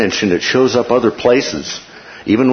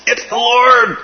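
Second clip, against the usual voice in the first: excited cry; 13 semitones higher, slower than usual. Retold or imitated. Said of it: "He says".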